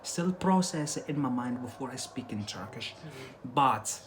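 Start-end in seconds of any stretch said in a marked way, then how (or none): none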